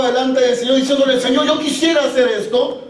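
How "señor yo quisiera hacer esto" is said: raised-pitch, pleading prayer of surrender; preacher leading an impassioned prayer; fervent prayer. Acted. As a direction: pray earnestly, phrase after phrase, building in pitch.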